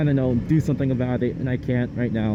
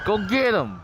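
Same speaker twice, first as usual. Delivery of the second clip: excited cheer; 9 semitones higher than usual. Real, not acted. Cheering on other riders.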